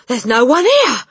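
loud, high burst of relief; greatly relieved. A narrator's reading. Father finds the room empty, relieved exclamation. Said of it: "greatly relieved".

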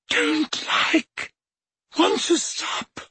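high breathy moaning plea; frightened moan. A narrator's reading. Dumbledore begging Harry to stop, moaning high. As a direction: moan it out, broken. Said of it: "moaned Dumbledore".

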